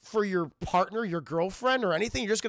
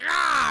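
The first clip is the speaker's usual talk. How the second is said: mock battle cry; 10 semitones higher, 9 dB louder than usual. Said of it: "he goes".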